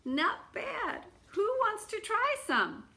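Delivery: high, cheerful beggar's offer; the beggar offering the borscht to the crowd. A narrator's reading. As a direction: invite everyone in, pleased.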